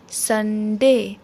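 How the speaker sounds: संडे drawn out as "sun - day"; syllable division. Shown as a demonstration. Teacher finishes Sunday for children, संडे slow and divided. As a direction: say संडे slowly in two parts. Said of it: "syllables division के ज़रिए".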